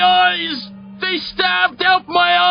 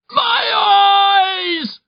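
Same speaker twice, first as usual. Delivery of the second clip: high, drawn-out anguished yell; panicked nightmare scream. A narrator's reading. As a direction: yell in blind terror. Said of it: "Dervish yells again".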